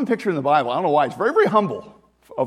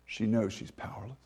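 normal talking voice

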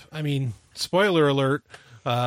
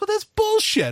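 indignant; 3 dB louder than usual. Retold or imitated. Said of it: "I was like".

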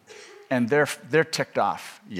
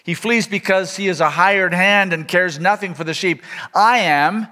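raised, declaiming Bible reading, a little higher; scripture reading; 4 semitones higher, 3 dB louder than usual. Acted. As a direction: proclaim the passage to the congregation. Continues reading John ten.

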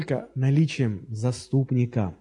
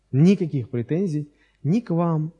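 ordinary talk

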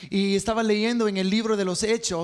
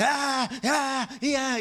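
mock shouting ah; 5 semitones higher, slower than usual. Preacher mocking a shouting minister, mock ah ah. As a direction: parody the shouting preacher's groans. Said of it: "uno grita y uno empuja".

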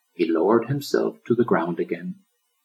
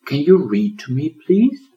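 high, louder little plea; pleading; 8 semitones higher, 6 dB louder than usual. A narrator's reading. Turtle asking lion to read to him, pleading. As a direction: beg sweetly.

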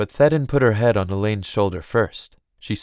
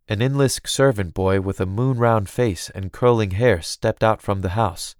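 usual speaking voice

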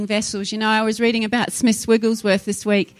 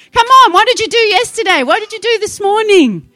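higher and louder, calling out the congregation; fired-up preaching shout; 9 semitones higher, 7 dB louder than usual. Real, not acted. Preacher challenging his church about today, loud and high. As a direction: demand it of them at full force.

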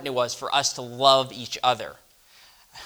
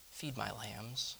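much softer and slow, tender; soft, gentle command; 15 dB quieter, slower than usual. Acted. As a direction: say it gently, barely above a murmur. Jesus answers Simon.

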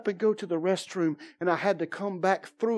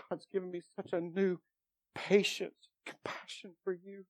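tender hush; 11 dB quieter than usual. Retold or imitated. Tender words to his son.

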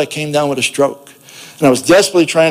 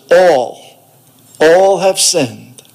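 slow emphatic; 2 semitones higher, 3 dB louder, much slower than usual. Retold or imitated. Pastor stresses all have sinned.

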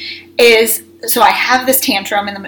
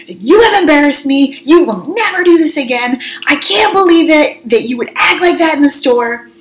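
raised, higher-pitched telling-off; retold angry scolding; 5 semitones higher than usual. Embarrassed mom turns round in the car, screaming at kids. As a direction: re-enact scolding the kids, each charge driven home. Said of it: "I just start screaming at them".

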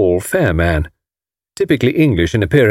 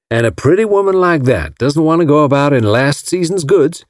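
put-on Alabama accent; 5 semitones higher than usual. A narrator's reading. Hank Ryder excusing Marguerite's bills, Alabama accent. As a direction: defend her like a smitten Alabama gentleman. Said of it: "Mister Ryder came from Alabama".

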